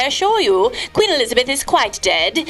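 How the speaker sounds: ordinary talk, nothing marked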